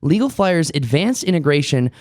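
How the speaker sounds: ad read. Acted. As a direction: sell it like a commercial. Sponsor ad read.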